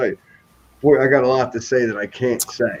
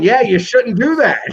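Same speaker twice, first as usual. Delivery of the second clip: mocking coworkers; 11 semitones higher, 5 dB louder than usual.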